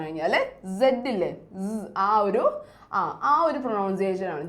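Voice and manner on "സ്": "സ്" as a buzzing z sound; letter sound demo. Shown as a demonstration. Teacher shows pupils the dotted letter's sound, letter sound demo. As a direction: say "സ്" alone as a soft, drawn z. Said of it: "സ് എന്ന് pronounce ചെയ്യും".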